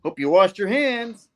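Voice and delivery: high-pitched quipping hero voice; Spidey. Acted. Hero taunting henchmen mid-fight, high-pitched quip. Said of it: "Spidey's all like".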